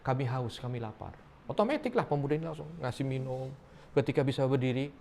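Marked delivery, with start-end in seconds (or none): 0.0-1.2 softer, a barely-there cry for help; faint, weak plea. Acted. Collapsed youths beg a passerby for water, weakly. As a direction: fade it out like someone half conscious.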